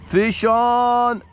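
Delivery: high, loud fish-on call; excited call. Real, not acted. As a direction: shout it out as the fish hits. Hooked fish, shouted out.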